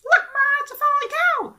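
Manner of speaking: put-on very high, loud kid voice; a kid yelling to mom from the backseat; excited child. Kid voice in imagined car scene.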